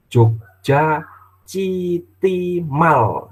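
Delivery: Jogja City Mall slow, mall said as English mol; English reading. Shown as a demonstration. Father demonstrating the correct reading of Jogja City Mall. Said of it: "misalnya Jogja City Mall itu".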